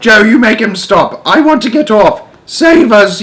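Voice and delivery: high, loud panicked plea; yelled. A narrator's reading. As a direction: shout to be heard over the wind. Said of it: "yelled Grandma Georgina".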